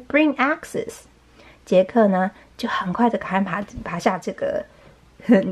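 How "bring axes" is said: higher-pitched, mild call; storybook yell, lightly acted. A narrator's reading. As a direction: call for help without real volume. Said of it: "Jack yelled to his mother".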